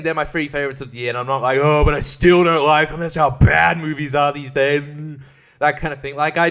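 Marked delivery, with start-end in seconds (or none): 1.6-4.8 loud, exaggerated film grumbler; imitating a disgruntled critic; mock complaining. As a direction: moan it like a put-on whiner. Mock complaint he is not making.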